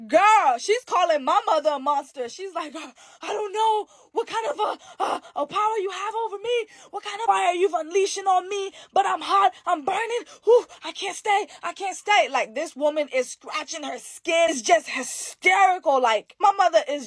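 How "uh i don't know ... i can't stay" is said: high, crying and shouting in pain; panicked crying. Retold or imitated. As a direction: cry out, desperate to escape the burn. Witch burning, begs and flees.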